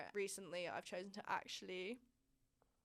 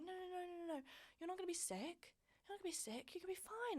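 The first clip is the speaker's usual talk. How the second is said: high, soft mock-reassuring boyfriend; imitating boyfriend; 10 semitones higher, 4 dB quieter, faster than usual. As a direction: play the boyfriend, higher, soothing her. Boyfriend voiced, reassuring her.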